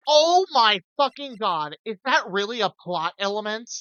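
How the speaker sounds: stunned outburst. Real, not acted. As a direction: blurt it out, genuinely floored. Real reaction to plot twist.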